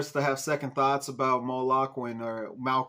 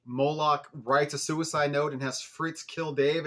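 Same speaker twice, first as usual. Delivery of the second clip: ordinary talk, nothing marked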